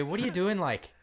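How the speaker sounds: imitating how Irish film characters talk, lightly; Irish accent. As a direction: tease a playful Irish accent. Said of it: "And they say".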